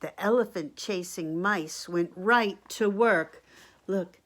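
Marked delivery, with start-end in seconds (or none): none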